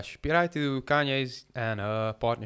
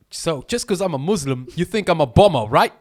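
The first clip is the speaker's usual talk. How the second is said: raised pitch, loud film-character protest; mock indignant challenge; 7 semitones higher, 9 dB louder than usual. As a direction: imitate him confronting the whole room.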